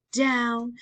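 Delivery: ordinary delivery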